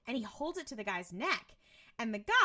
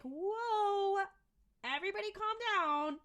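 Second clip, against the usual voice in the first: retold alarmed placating; 11 semitones higher than usual. Dean to robber holding him at gunpoint, alarmed placating. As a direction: imitate talking down a gunman, pitched up.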